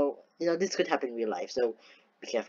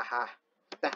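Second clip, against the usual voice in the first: normal talking voice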